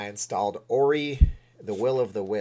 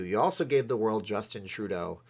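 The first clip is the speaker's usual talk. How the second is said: ordinary talk, nothing marked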